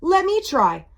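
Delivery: cocky storybook vampire; boastful challenge. A narrator's reading. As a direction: step up boldly, punching it out. Boasting vampire takes his turn.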